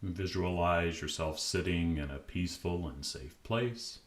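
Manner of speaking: calm, unhurried meditation voice with long pauses; guided meditation. Acted. Podcast host guiding listeners to relax, calm meditation voice. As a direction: ease the listener gently into stillness.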